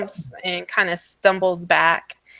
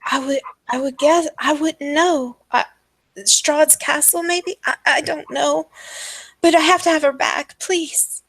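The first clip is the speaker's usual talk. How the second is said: high, helpless halting mother; pleading madwoman; 9 semitones higher than usual. Acted. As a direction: beg anxiously in a higher, stammering voice. Game master plays pleading mother.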